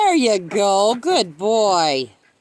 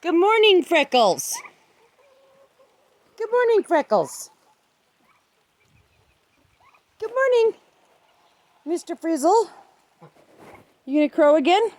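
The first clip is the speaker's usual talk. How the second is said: pet talk; 7 semitones higher than usual. Real, not acted. Greeting chickens in pet voice.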